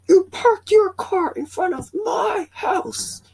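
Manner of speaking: cranky neighbor yelling about his car; Kevin voice. Acted. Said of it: "I can't really do my Kevin voice".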